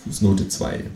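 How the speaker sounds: ordinary delivery, nothing marked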